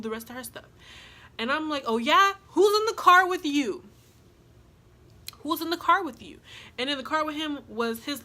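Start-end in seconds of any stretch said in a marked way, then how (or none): 1.9-3.8 high, loud confrontational demand; loud challenge. Retold or imitated. Challenging the baby daddy about his passenger, loud demand. 5.4-6.4 higher, mildly heated demand; retold challenge. Pressing the baby daddy about his passenger, mildly heated.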